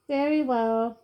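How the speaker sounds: aloof. A narrator's reading. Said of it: "he said aloofly".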